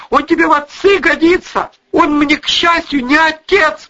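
high, loud squealed outrage; shrieking. A narrator's reading. Daughter rejects mother's lover as father, shrieking. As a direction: shriek the protest, pitch way up. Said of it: "взвизгнула Лиля".

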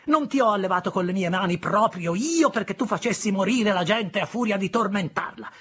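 high, loud scolding, slowing at the moral; stern sister hammering the moral; angry lecture. Acted. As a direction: lecture the boy to frighten him.

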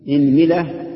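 إنملة as "inmila", i on hamza and meem; i then i. Shown as a demonstration. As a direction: say إنملة firmly as IN-mi-la. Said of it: "اكسر الهمزة".

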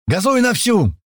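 high, urgent yell; yelled order. Acted. Senior man yells to Слива at the wheel, commanding. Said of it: "орёт старший".

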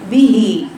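به with the kasra cut short toward fatha; wrong short kasra. Shown as a demonstration. Teacher shows the student's wrong به. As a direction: say به with a clipped, half-open kasra. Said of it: "تقرأها هكذا".

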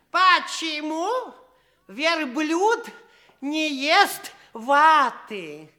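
slow, drawn-out riddle. Acted. The servant poses a riddle to the prince, slow and drawn out. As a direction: pose it slowly for suspense. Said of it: "первая загадочка будет такая".

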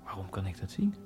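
usual speaking voice

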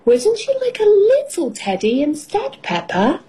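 high, coaxing story-mum voice; coaxing question. A narrator's reading. Mummy Pig offers Peppa a smaller teddy, coaxing high. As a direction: pitch it high, then drop on the name.

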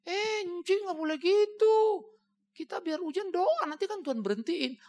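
put-on high, slow little-kid voice, gently scolding; child's earnest rebuke. Little pupil scolding her teacher to pray, high and slow. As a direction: play a small pupil lecturing her teacher about faith.

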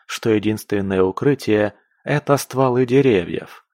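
ordinary delivery

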